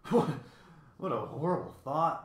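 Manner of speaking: mild shudder of horror; understated horrified recoil. A narrator's reading. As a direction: stammer the start, appalled by the thought. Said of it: "the bird shuddered".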